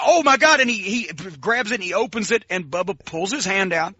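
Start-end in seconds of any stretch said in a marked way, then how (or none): 0.0-0.6 higher, louder startled cry of alarm; shocked gasp. Retold or imitated. Cab driver realizing he slammed the door: startled cry. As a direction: blurt it out in sudden horror.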